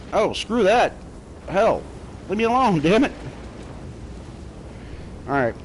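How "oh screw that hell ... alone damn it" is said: high panicked shouting; frantic pleading. Real, not acted. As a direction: cry out, rattled. Hunted by enemy in game.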